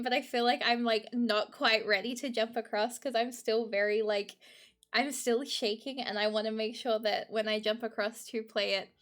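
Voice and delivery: usual speaking voice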